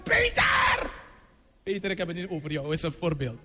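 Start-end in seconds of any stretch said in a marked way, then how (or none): 0.0-0.9 high, piercing shout of a name; acted scream. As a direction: cry out the name at full pitch. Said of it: "Zij schreeuwen". (hoge, geschreeuwde uitroep)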